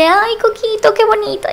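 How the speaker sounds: high-pitched affectionate cooing; cooing pet talk. Shown as a demonstration. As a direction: coo sweetly to a beloved pet. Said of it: "le hablo así de".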